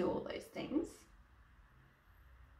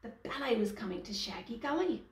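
usual speaking voice

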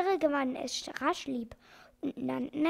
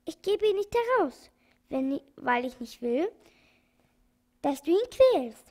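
stern outburst; 3 semitones higher, 6 dB louder than usual. A narrator's reading. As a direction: let the doctor's anger break through. Doctor refuses the organ grinder.

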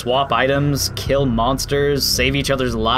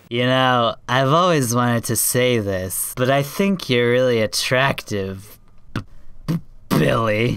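shy, stammering love confession; hesitant flirty role, tripping over the name; Rebecca flirting; 2 semitones lower than usual. Acted. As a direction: play a nervous, stuttering flirt. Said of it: "if Rebecca was the one flirting".

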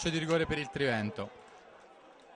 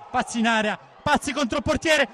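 high, loud building call; excited commentary; 9 semitones higher, 5 dB louder than usual. Real, not acted. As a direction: build to a shout of the name. Chance on goal.

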